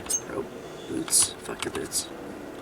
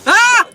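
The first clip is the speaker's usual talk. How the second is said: sudden high, loud yelp of shock; loud startled "ah"; startled scream; 10 dB louder than usual. Real, not acted. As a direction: scream as if jumped from nowhere. Jumped by assassins in the game.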